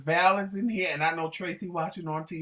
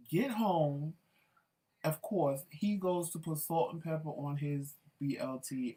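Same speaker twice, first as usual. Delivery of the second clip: ordinary talk, nothing marked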